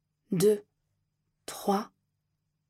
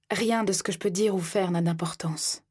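usual speaking voice

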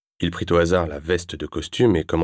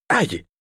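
high yelp of pain; sharp cry of pain; 11 semitones higher, 3 dB louder than usual. Acted. Stung by antiseptic, he yelps at his ex-wife. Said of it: "cria-t-il".

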